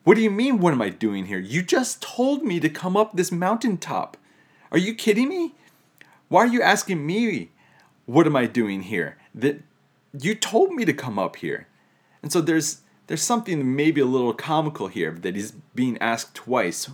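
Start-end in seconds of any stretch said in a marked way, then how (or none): 0.0-11.7 much higher, louder, incredulous protest; exasperated Elijah. Retold or imitated. Elijah protesting to God on the mountaintop, high incredulous exasperation. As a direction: play Elijah baffled at being asked why he came. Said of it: "It's like".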